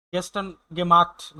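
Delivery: spoken very slowly, word by word; slow German example. Shown as a demonstration. Teacher reading a German phrase slowly to students. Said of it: "teaching German phrase".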